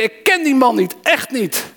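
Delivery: high-pitched, hammed-up panicked denial; blind panic. Peter swears to the bystanders he doesn't know Jesus, acted panic. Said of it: "In blinde paniek begon ik te vloeken en ik zweerde".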